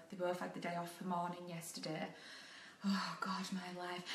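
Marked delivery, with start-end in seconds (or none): 2.9-4.0 breathy whispered despair to herself; whispered oh God my life; whispered sigh. Real, not acted. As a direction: mutter it under your breath, defeated. Exasperated aside.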